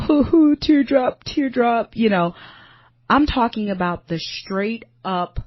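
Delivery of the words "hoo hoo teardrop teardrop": higher, louder, hammed-up little sob; mock dainty crying. Mocking a dainty little cry compared with her ugly cry. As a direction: mime a cute, dainty cry. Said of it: "I'm not talking about just the".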